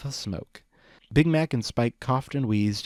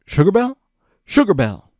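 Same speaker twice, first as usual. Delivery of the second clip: loud, high calling out for a lost friend; worried friend calling loudly through the halls; 9 semitones higher, 11 dB louder than usual. Acted. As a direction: shout her name into the dungeon. Big Mac calls for lost friend.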